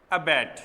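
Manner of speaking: अबैट said ab-AIT, o silent; pronouncing the word. Shown as a demonstration. Teacher modelling अबैट as ab-ait, not abbot. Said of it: "इसका pronunciation आप लोगों को समझना पड़ेगा".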